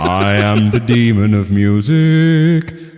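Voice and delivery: slow, theatrical phantom voice; Phantom of the Opera. Acted. Joking with his friend about the opera, slow hammed-up phantom voice. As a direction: intone it slowly like a stage phantom. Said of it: "That's a joke for people who've seen Phantom of the Opera".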